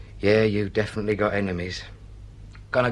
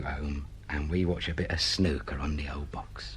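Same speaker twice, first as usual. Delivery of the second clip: whispered coax; 4 semitones lower, 7 dB quieter than usual. Acted. Coaxing him home, softly.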